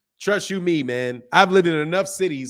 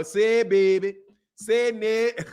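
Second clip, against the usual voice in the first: playful New Orleans imitation; New Orleans accent; 5 semitones higher, slower than usual. Streamer riffs on an accent he misses, New Orleans accent. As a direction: mimic the New Orleans way of talking. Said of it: "I love the accent".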